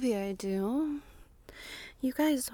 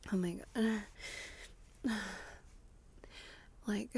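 usual speaking voice

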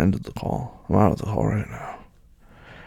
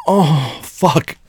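loud cry; 6 semitones higher, 6 dB louder than usual. Acted.

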